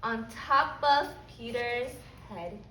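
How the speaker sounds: put-on lively storybook reading for little kids; reading to children. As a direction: read it like story time for a class. Teacher reads picture book aloud.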